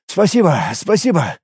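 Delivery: slightly high-voiced flustered thanks; gushing mock thanks. A narrator's reading. Pretend victim to the guards, gushing thanks. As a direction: thank them like a rattled victim.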